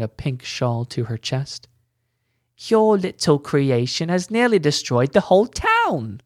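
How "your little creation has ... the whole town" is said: higher, louder furious scolding; angry shout. A narrator's reading. Woman scolding Bertrand for the giant, angry shout. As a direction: blame him for the ruin. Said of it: "shouted a woman".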